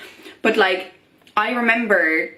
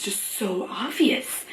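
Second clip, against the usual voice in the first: whisper; 7 dB quieter, slower than usual. Real, not acted. Emphatic hushed aside.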